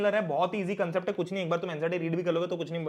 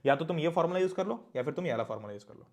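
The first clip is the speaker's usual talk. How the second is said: ordinary talk, nothing marked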